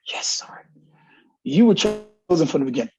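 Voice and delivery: whispered, hushed aside; mock-reverent whisper. Coach reacting to a young recruit's school, in a mock whisper.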